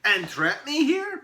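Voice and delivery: high, loud, drawn-out protest; affronted little elf; indignant elf. Acted. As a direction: balk in outrage. Elf alarmed at suggestion.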